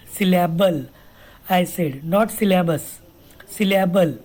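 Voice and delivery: ordinary delivery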